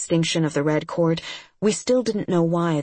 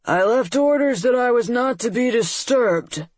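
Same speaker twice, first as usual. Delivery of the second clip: sleepy, slurring monster lord; slurred voice; 6 semitones higher, 4 dB louder than usual. Acted. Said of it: "a slurred voice".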